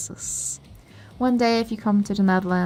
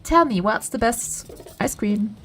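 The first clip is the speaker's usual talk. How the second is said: ordinary delivery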